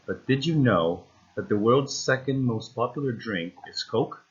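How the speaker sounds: main stress on "know" and "second", lighter on "popular", "drink", "Coke"; primary and secondary stress. Shown as a demonstration. Coach reads so students hear the stresses, primary and secondary stress. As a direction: hit "know" and "second", then lift "Coke". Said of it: "read this sentence right here with the primary".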